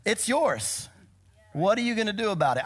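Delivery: high, quick, cheeky dare; tenacious teasing challenge; defiant challenge. Retold or imitated. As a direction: toss the problem back with a laugh. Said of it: "he's like".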